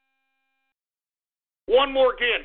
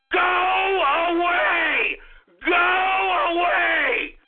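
angry shout stretching out the words; furious yelling; slower than usual. Real, not acted. Host telling listeners to go away, furious yelling. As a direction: yell to drive them away, dragging the last words.

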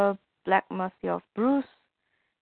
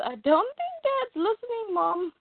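put-on high, childlike voice for the son; lightly played young boy talking to mom; little boy voice; 13 semitones higher, 3 dB quieter than usual. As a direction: pitch it way up like a small kid. Boy John voiced in retelling.

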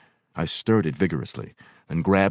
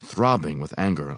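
plain reading voice

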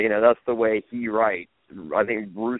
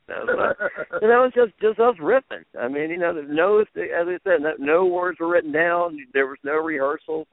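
usual speaking voice

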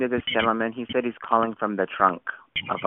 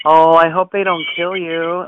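mock alarm; 4 semitones higher, 10 dB louder than usual. Caller mock-worried about kidnapping.

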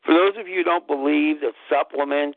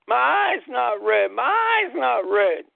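higher, slow triumphant shout; yelling out; 7 semitones higher, slower than usual. Retold or imitated. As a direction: shout the good news. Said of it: "For me to be yelling out".

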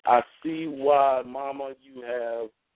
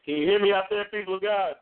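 raised voice, higher and louder; calling from far away; 6 semitones higher, 4 dB louder than usual. Real, not acted. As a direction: call out to the whole congregation. Calling out to the prayer line.

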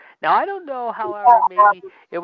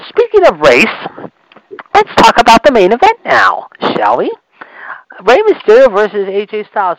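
loud rant; 5 semitones higher, 13 dB louder than usual. Real, not acted. Ranting vlogger announces main event.

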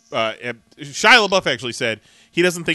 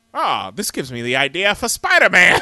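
lightly put-on voice, higher and louder; Stan Lee; 4 semitones higher, 7 dB louder than usual. Comic host acting out a creator's big idea, mock-excited. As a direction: exclaim it like a eureka moment. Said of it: "and then he's like".

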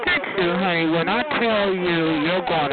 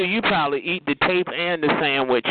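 usual speaking voice

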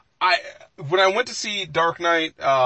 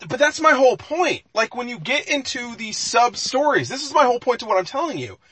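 animated debate voice; excited insistence; 7 semitones higher than usual. Real, not acted. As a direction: jump in fired up to win the argument. Heated argument over Marvel films.

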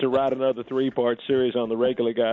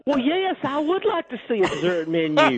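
woman's voice; 9 semitones higher than usual. Pretend. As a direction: answer like a giddy woman. Playing the woman answering.

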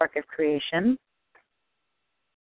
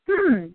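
duck's pitched-up musing "Hmm"; high thoughtful hum; 9 semitones higher than usual. Acted. Caller telling a joke, the duck humming high before the punchline. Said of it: "says the duck".

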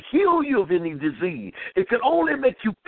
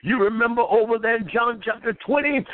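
normal talking voice

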